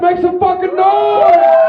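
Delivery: drawn-out, rising host's holler; rally the crowd, stretching the last word; hype shout. Real, not acted. Host hyping the crowd.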